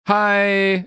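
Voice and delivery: put-on high drawn-out Valley Girl "hi". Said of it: "It's like Valley Girl".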